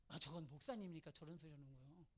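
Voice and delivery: low, soft doubter's remark; quietly voiced sceptical listener; acted dismissive aside. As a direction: say it under your voice, brushing it off. Voicing a doubting church member.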